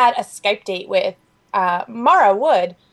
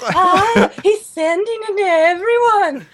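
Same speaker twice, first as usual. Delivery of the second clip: high, loud drawn-out outrage; comic high-pitched alarm; scandalized grandma impression; 12 semitones higher, 3 dB louder, slower than usual. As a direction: crow it like a gossiping grandmother. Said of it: "she starts in on Zoe".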